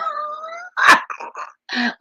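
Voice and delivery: sudden high-pitched shriek of laughter; high squealing laugh. Real, not acted. Laughs about vicious dog.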